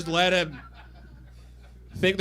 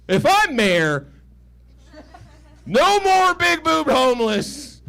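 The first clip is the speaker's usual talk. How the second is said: mock mayor speech; 9 semitones higher, 6 dB louder, slower than usual. Mock campaign promise.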